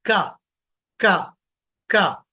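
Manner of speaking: क thrown forward from the front of the mouth, loud; demonstrating a forward क for the class. Shown as a demonstration. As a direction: push the क sound out to the front. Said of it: "आवाज सामने फेंको".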